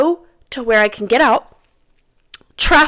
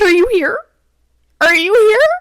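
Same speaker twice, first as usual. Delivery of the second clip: high-pitched insistent questioning; retold panic; 12 semitones higher, 4 dB louder than usual. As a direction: replay a frantic phone call, pitch climbing. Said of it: "I'm freaking out".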